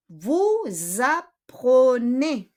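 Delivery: very slow "vous apprenez", S linked to the A as Z; Z liaison. Shown as a demonstration. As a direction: draw out "vous apprenez" as vou-zapprenez. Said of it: "ididikit mo siya sa A".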